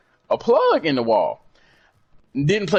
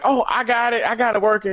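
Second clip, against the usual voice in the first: a hint of a higher, pleased girlfriend voice; lightly voiced girlfriend; 10 semitones higher than usual. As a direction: chirp it like she fixed it. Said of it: "she was just like".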